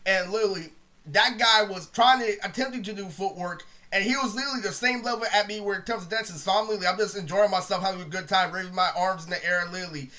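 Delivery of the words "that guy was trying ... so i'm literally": ordinary talk, nothing marked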